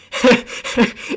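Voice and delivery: airy, high burst of laughter; breathy high laugh. Real, not acted. Laughing at calls for greatest fight ever, a breathy high laugh.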